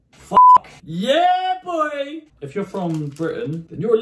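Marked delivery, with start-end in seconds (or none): 0.1-0.7 sudden loud, high-pitched curse; shouted curse. Real, not acted. Pouring the liquid, he yelps a curse as it spills. 0.8-2.2 hype cheer. Real, not acted. After a successful pour, he hypes himself up with a cheer.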